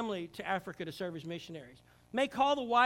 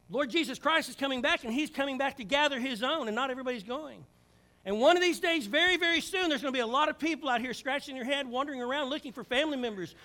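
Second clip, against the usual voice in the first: louder, higher climax of the sermon; full-force preaching; 5 semitones higher, 6 dB louder than usual. Acted. Preacher urging the church to wake up, full force. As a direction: build it to a passionate peak.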